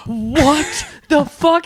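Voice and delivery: high, slow, drawn-out incredulous what the fuck; stunned disbelief. Retold or imitated. Friend reacting to the lisp reveal, stretched-out disbelief.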